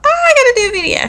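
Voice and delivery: high, fast excited thought; sudden realization. Retold or imitated. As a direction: blurt it out in a high rush. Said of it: "I thought".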